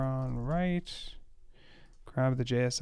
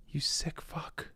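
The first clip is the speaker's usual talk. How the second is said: whisper; 3 semitones higher, 6 dB quieter, slower than usual. Real, not acted. Aghast at coworker's manual spacing.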